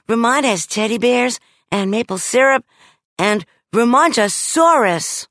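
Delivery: put-on quick, high girl listing treats. A narrator's reading. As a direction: rattle off the wishlist brightly. Judy voice, dreamy list.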